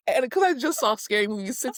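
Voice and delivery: normal talking voice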